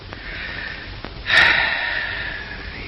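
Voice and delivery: long exaggerated sigh of admiration; a hammed-up appreciative sigh; mock sigh. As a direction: sigh as if deeply moved. Narrator admiring the scene.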